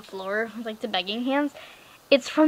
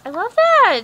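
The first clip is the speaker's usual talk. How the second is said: high, loud delighted squeal over the duck; excited squeal; 10 semitones higher, 12 dB louder than usual. Real, not acted. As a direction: gush about the little duck. Gushing over the rubber duck.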